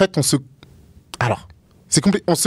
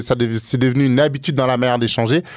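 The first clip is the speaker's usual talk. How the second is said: usual speaking voice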